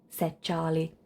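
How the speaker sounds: ordinary delivery, nothing marked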